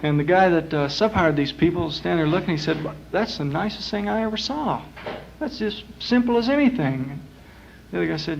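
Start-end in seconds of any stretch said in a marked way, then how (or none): 3.1-7.2 higher-pitched, marvelling; impressed. Acted. Client admiring the gorilla catcher's trick, impressed. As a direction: voice lifted in simple admiration.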